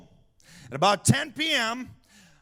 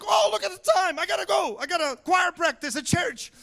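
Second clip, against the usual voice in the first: high-pitched mock panic; hyper-emotional cousin; 9 semitones higher than usual. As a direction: play a frantic cousin, pitched way up. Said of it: "hyper emotional".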